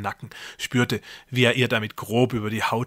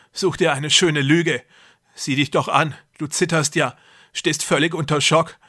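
higher, louder, falsely soothing brother; deranged brother feigning kindness; 5 semitones higher, 4 dB louder than usual. A narrator's reading. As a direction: sweet-talk her after the attack. Jakob feigning tenderness.

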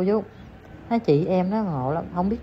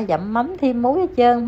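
normal talking voice

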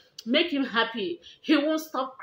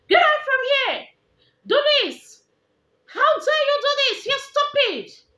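put-on much higher, louder furious yelling at the child; angry scolding; 13 semitones higher, 7 dB louder than usual. Mother acting out yelling at her provoking son, furious shout. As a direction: blow up at the child, shrill with anger.

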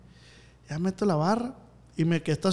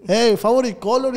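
mock broken English; 6 semitones higher, 8 dB louder, slower than usual. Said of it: "andaba como Marquito".

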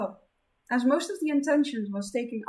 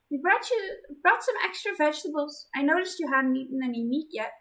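put-on higher, sweet young woman; gentle girl voice; 7 semitones higher, 4 dB louder than usual. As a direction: kind caring girl. Zenith voiced high.

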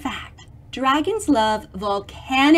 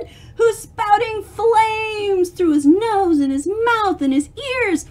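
dramatic excitement; 8 semitones higher than usual. Real, not acted. Dramatic describing flames, high.

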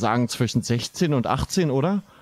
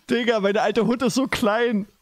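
high-pitched talk between laughs; amused, laughing; 8 semitones higher than usual. Real, not acted. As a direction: squeeze it out between bursts of laughter. Still laughing about the small dog.

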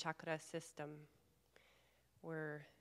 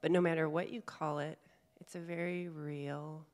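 calm meditation guide, long pauses between phrases; guided meditation; 5 dB louder than usual. Real, not acted. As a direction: gently guide them inward, leaving space to breathe. Leads a guided chakra meditation.

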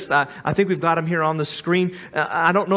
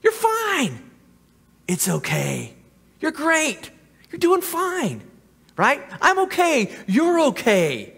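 much higher, sugary mock-reassuring voice; hammed-up soothing tempter; Satan's false comfort; 11 semitones higher than usual. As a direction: coo it like a flatterer lulling someone. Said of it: "he'll be like".

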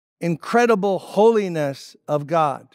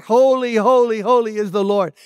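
imitated crying out. Seraphim crying out before the prophet, imitated proclamation. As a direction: cry it out like the seraphim, moderately raised. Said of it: "seraphim crying out".

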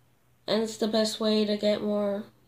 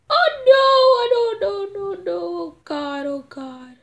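very high loud wailing no; real panic, fading out; high panicked wail dying away; panicked cry; 12 semitones higher, 6 dB louder than usual. Real, not acted.

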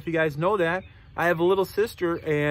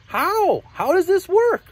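high, loud puzzled questions; snake character; 10 semitones higher, 5 dB louder, slower than usual. Acted. Snake questions friends' plan, high loud puzzled voice. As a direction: demand an answer, voice pitched way up. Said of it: "And the snake says".

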